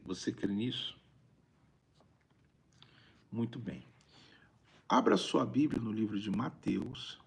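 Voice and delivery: normal talking voice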